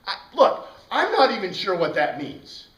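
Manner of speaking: mock puzzled student. Teacher acting student's puzzled reply.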